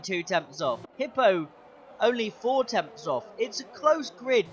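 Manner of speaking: ordinary talk, nothing marked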